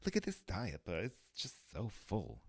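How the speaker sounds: high-pitched fifties housewife; mock woman's voice. Mommy fussing over a diaper, mock woman's voice. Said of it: "do a female woman's voice".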